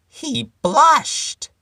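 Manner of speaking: comic squeak. Shown as a demonstration. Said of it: "punchline of the chameleon poem".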